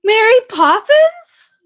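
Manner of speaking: high, loud and slow, stretched out; excited fan squeal. Retold or imitated. Starstruck fan greeting the actor with a squeal. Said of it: "and I was like".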